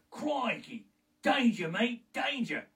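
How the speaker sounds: Steve Irwin impression. Aussie wildlife host warning of danger, high and thrilled. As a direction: yelp it like an excited Australian wildlife host. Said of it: "like great Steve Irwin".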